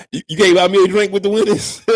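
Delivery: loud, high, outraged question; you can't be serious, loudly; indignant disbelief. Real, not acted. To the viewer who won't share winnings, loud indignant disbelief.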